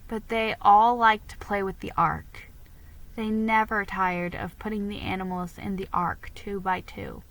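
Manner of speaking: plain reading voice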